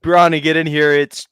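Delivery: loud, high holler, drawn out at the end; calling out. Acted. Calling Bronny in for Taco Tuesday, mock holler. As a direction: summon the household with a big stretched-out yell.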